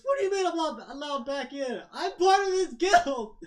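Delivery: high, loud comic protest; indignant outburst. Acted. Beaten fighter is barred from his guild, high and indignant. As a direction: protest loudly, dragging out the final words.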